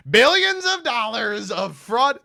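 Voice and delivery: loud, high, slow all-caps shouting; blaring mock proclamation; Donald Trump. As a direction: bellow it like an all-caps post. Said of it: "Let's check out his other posts".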